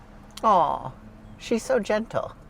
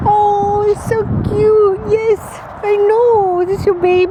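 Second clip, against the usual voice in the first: high, loud squealing coo; 14 semitones higher, 7 dB louder than usual. Real, not acted. Owner fawns over the puppies, high squealing baby talk. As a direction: gush over the cute puppy. Cooing at puppy and dog.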